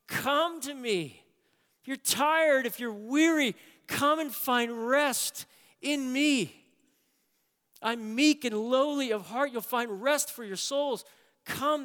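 high, slow, loud pleading call; preacher voicing Jesus' invitation, impassioned; Jesus inviting. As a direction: call out the invitation with full fervour. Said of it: "Jesus walk through the pages ... saying".

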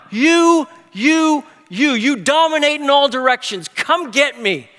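high, punched repeated "you"; bold triumphant cry. Acted. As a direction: shout the praise in defiance. Psalmist's bold cry to God.

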